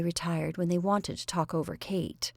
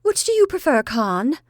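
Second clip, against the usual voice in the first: much higher, loud, bright question; 7 semitones higher, 7 dB louder than usual. A narrator's reading. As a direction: chirp it brightly to her sister. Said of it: "said quite brightly".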